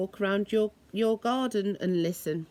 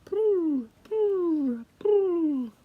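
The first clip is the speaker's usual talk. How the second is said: high cooing poo; pigeon coo; 8 semitones higher, much slower than usual. Retold or imitated. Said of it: "nodding their head and say".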